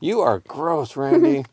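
mildly grossed-out cartoon cat; disgusted cat. A narrator's reading. Cat disgusted by Randy eating.

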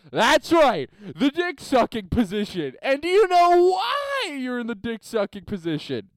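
loud, high, ranting coach; big acted shout, drawing out WHY; coach's rising yell. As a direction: holler it at the whole team. Acts coach working up to fury.

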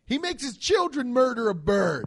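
loud, high outrage; appalled emphasis. Real, not acted. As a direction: hammer home how shocking it is. Outraged telling of turkey killing.

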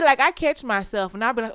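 usual speaking voice

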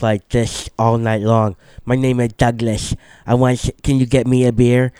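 imitating a friend's put-on slushy lisp; mock speech-impediment accent, played for laughs. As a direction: talk with every S slurred into SH. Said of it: "he kinda talked with a speech impediment".

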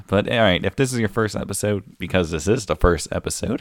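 ordinary talk, nothing marked

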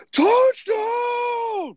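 long high touchdown shout; announcer touchdown yell. Retold or imitated. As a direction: stretch the touchdown call. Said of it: "'Cause that one wins when they go".

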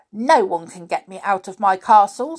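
loud, quacking creature voice. A narrator's reading. Snoogle refusing to leave his castle, quacking. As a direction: quack it out defiantly. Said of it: "in a quacking sort of voice".

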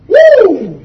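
high, loud, joyful whoop. Real, not acted. Preacher whoops with joy at the climax. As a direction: let out a whoop of praise. Ecstatic cry.

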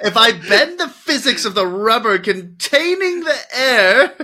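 high, cracking-up mock reasoning; laughing mockery. Real, not acted. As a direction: tease through laughter, pitched high. Mocking friend's idea through laughter.

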